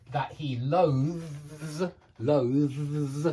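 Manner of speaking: "loathes" slowly, buzzing voiced th then z; slow demo of "loathes" with voiced th; pronunciation demo. Shown as a demonstration. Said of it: "voiced T H and then a Z".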